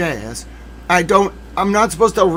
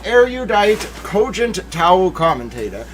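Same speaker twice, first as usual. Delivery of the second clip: ordinary talk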